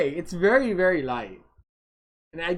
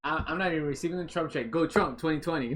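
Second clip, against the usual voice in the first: ordinary talk, nothing marked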